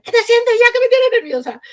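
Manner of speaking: snapping at a restless pupil after trying to stay calm; exasperated yell. Retold or imitated. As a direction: yell at the class, fed up. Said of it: "no voy a gritar".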